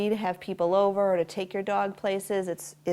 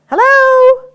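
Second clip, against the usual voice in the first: very high, loud doorbell hello; loud distraction hello; 17 dB louder than usual. Shown as a demonstration. Trainer testing the dog with a loud greeting, high and loud. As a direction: sing it out like greeting a guest at the door. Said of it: "when I say it".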